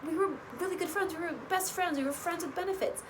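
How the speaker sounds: mock her building outrage; lightly voiced upset friend; upset Christina. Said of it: "and she says".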